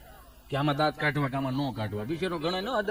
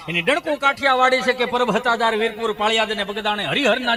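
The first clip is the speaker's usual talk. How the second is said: poem recital; 10 dB louder than usual. Acted. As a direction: proclaim the poem to the crowd. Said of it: "કોક કવિને લખવું પડ્યું છે".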